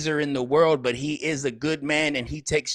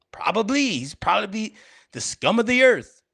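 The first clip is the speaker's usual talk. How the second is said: loud; 7 semitones higher, 4 dB louder than usual. Acted. Mock outburst about the rapper.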